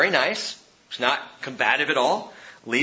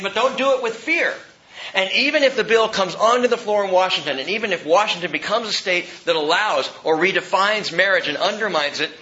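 higher, louder preaching; preaching at full force; 7 semitones higher, 4 dB louder than usual. Real, not acted. Pastor urging the church not to fear, high and loud. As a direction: build it to a rousing climax.

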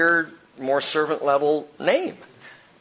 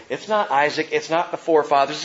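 normal talking voice

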